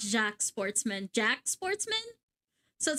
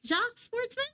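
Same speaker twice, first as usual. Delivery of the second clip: high doubtful query; 10 semitones higher than usual. Shown as a demonstration. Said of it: "So it's like Jacques".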